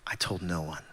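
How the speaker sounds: low and soft, after a long pause; quiet admission of shame; grave confession. Real, not acted. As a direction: let it land heavy. Heavy confessional beat.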